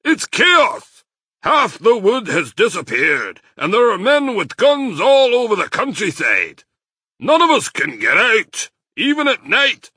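loud, high-pitched alarm; excited. A narrator's reading. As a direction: pour out the bad news at full pitch. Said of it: "Badger said excitedly".

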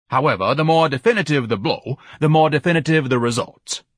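grandiose, high-pitched orator; rally the workers pompously; lofty commander reviewing his troops; pompous Ignatius. Acted. Ignatius addresses his army.

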